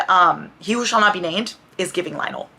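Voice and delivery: normal talking voice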